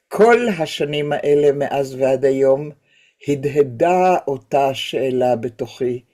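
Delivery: measured Hebrew poetry recital with pauses between phrases; poem reading. Acted. Teacher reads a poem in Hebrew to the class, poem reading. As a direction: recite the verse solemnly, letting line endings sink.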